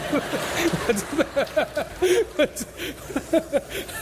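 long high laugh at himself; burst of laughter. Real, not acted. Preacher laughs at his own story.

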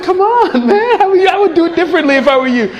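half-laughing impatient plea to God; excited mock complaint. Impatient believer quoted to God.

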